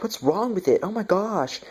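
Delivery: mock alarm. Retelling panicked movie characters.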